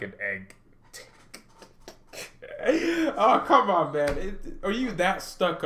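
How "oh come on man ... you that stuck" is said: high laughing outburst dropping into words; laughing protest. Real, not acted. Streamer defending his egg-head joke, laughing protest. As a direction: protest through a burst of laughter.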